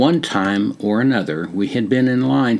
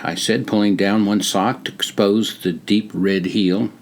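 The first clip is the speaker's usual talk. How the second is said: ordinary delivery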